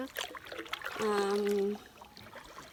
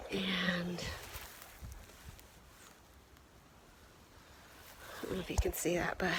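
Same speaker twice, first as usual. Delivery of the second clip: whispering; 2 semitones lower, 10 dB quieter than usual. Real, not acted. Whispered aside about slip.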